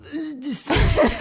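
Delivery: put-on French accent, drawn out and laughing; guttural French accent. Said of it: "make it real guttural if you're doing the French".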